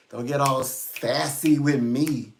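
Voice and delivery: slow, drawn-out sassy warning. Real, not acted. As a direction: stretch the words out with attitude. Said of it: "'cause I get sassy".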